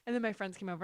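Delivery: usual speaking voice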